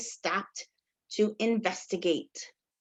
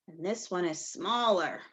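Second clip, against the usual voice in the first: "smaller" said lower and softer; 7 semitones lower, 6 dB quieter than usual. Shown as a demonstration. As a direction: shrink the voice on "smaller". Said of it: "Are they the same?".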